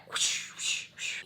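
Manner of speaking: soft unvoiced swishing swipes; imitated whoosh. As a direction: make quick whooshing noises with breath. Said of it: "he was just like".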